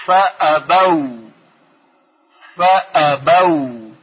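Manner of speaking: فابو stretched very slowly, final الف silent; فابو drawn out, ending on "u"; slow demo. Shown as a demonstration. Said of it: "الف ناخواناست".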